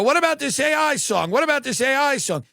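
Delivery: high, quick mock hype; mock announcer. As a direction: push the song like an eager cabin announcer. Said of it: "And they go".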